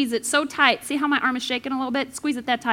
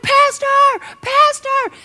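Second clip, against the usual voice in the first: much higher, loud calls for help; panicked shout; 12 semitones higher, 8 dB louder, slower than usual. Retold or imitated. Rider calling the pastor as the cliff nears, panicked shout. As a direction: yell for the pastor in terror.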